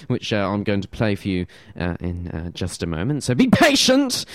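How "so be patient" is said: high, loud mock command; playful shout. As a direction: call out to the listeners playfully. Host teasing waiting listeners.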